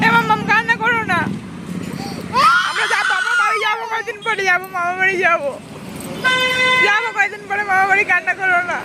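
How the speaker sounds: calls out to calm the little one over the noise; loud soothing. Real, not acted. Mother soothing a screaming child. (কাঁদতে থাকা মেয়েকে জোরে, উঁচু গলায় সান্ত্বনা)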